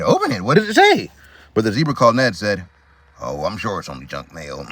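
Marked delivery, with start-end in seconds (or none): 0.0-1.1 eager. Acted. Eager animals voice. 3.2-4.7 low, muttered brush-off; low dismissive voice. Acted.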